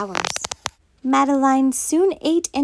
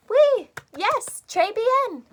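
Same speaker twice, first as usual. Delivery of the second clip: praise; 10 semitones higher, slower than usual. Real, not acted. Praising children's repeat.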